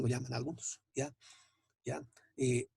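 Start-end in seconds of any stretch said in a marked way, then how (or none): none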